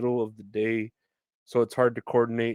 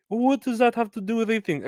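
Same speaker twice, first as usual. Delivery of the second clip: fast, much higher indignant mock voice; defensive customer; 11 semitones higher, faster than usual. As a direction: rush it out high and huffy. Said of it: "customer snaps back".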